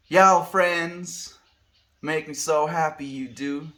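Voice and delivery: high, loud Southern US accent; put-on Southern accent. Shown as a demonstration. Streamer trying out Southern for friends, exaggerated Southern accent. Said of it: "Can I do Southern?".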